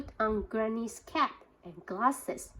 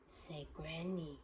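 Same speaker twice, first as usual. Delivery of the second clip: plain reading voice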